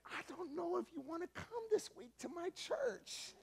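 high, doubtful put-on voice; hesitant. Unsure about bringing roommate, high hesitant voice. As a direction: hedge nervously, voice up high.

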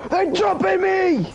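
high, slow alarmed yelp; startled squeal of alarm; panicked cry. Real, not acted. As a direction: shriek as they jump you. Being attacked in game.

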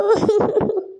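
a short broken sob. Acted. Mother breaking down before her husband, sobbing. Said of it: "ಅಳಬೇಡ, ಸಮಾಧಾನ ಮಾಡ್ಕೊ".

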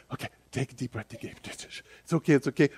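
put-on soft, hushed comfort to a crying child; soothing a child. Parent calms a sobbing child, soft and soothing. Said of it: "And you're like".

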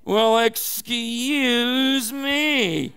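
very slow, drawn-out sulky whine; whiny excuse me. Retold or imitated. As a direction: stretch it out like a sulking teen. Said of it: "David sounds a little whiny".

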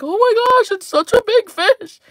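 imitating an amazed young boy; awed kid. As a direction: voice the wide-eyed kid. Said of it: "He's like".

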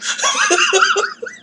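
a very high, giddy squeal of a laugh. Real, not acted. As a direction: laugh at himself, embarrassed. Laughs at being a simp.

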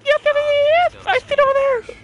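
high-pitched gleeful squeal; excited squeal. Real, not acted. Player spotting something in the game, excited squeal. Spots the thing he wants, squeals.

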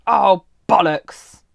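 slow, loud exasperated oath; real annoyance, loud and drawn out; loud curse. Real, not acted. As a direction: draw out the curse at the mistake. Realises she made a mistake.

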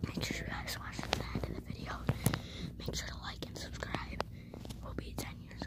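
soft, tense hiding whisper. Real, not acted. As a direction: barely breathe the words. Hiding in parents' room.

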